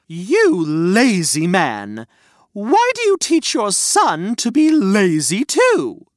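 slow, loud scolding; shouting mother. Acted. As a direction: scold him, dragging each word. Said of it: "She also shouted".

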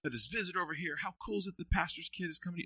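put-on bubbly young pastor bragging about the night; excited self. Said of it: "I'm like".

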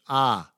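"Ah" as the letter R with no r sound. Retold or imitated. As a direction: say the letter R as a firm open "Ah". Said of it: "can't pronounce the letter R".

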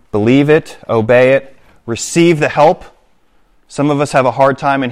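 rally the church to act; preaching. Acted. Closing application to congregation.

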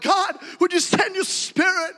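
high, loud, slow churchy pray-er; mock fervent prayer. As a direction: ham up a pious prayer. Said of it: "we're like".

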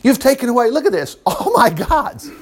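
high-pitched outraged complaint; indignant retort. Acted. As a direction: protest the theft. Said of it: "Micah retorted".

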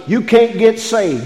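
normal talking voice

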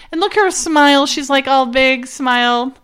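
normal talking voice

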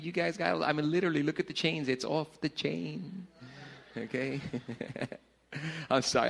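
usual speaking voice

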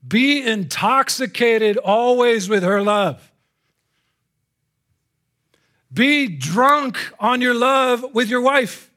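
loud, high, slow proclamation; drawn-out, forceful pulpit cry; commanding preaching. Real, not acted. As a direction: deliver the verse as a ringing command.

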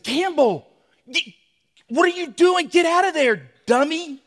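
high, loud yell at his son; retold panicked shout at his son; angry yell. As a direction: snap at the boy to get out. Dad yelling at son under tree.

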